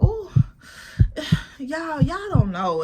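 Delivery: overwhelmed sigh. Retold or imitated. Overwhelmed reaction retold.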